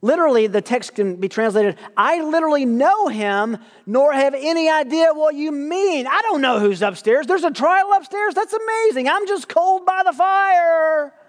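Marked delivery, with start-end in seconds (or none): 2.0-11.1 emphatic frantic denial. Acted. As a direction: play Peter overdoing his innocence, pitch way up. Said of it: "Peter goes to great lengths to deny the Lord".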